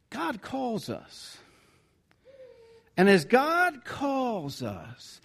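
God calls us drawn out slowly; slow and deliberate. Acted. Preacher stating the sermon's main point, slow and deliberate. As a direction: let each word land slowly.

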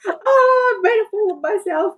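very high, hammed-up crying voice; mock tearful wail. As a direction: wail it like a tipsy, tearful self-imitation. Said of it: "I ended up in tears going".

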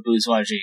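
"bourgeoisie" stumbled as booz-wah-zhee; unsure pronunciation. Real, not acted. Cook announcing a joke menu, unsure pronunciation of "bourgeoisie" as booz-wah-zhee. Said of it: "How do you pronounce that word?".

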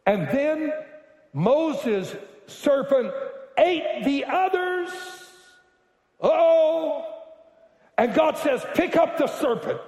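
high-pitched, drawn-out climactic exclamations; excited preaching. Real, not acted. Preacher tells how Moses' serpent ate others, excited cry.

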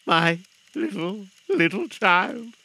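slow, halting, choked-up plea; grief-stricken cry. Acted. Father mourning his little child, slow rising grief. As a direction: draw each word out, grieving. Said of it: "cried Bob".

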